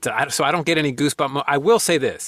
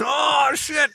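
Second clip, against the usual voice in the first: excited shout; 13 semitones higher than usual. Retold or imitated. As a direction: yelp with childish excitement. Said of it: "You're just".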